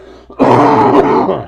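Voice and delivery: loud harsh cough. Real, not acted. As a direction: cough hard, sick. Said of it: "Sorry for the coughing".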